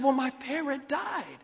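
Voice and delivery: slow, high-pitched mock-mournful news; sad. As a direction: break the bad news slowly, with acted sadness. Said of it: "she looked sad".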